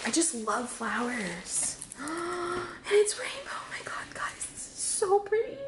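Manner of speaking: soft, tearful near-whisper, rising high; overcome, voice dropping to a breathy whisper; real happy tears in a hushed voice; tearful joy. Real, not acted. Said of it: "I'm literally tearing up".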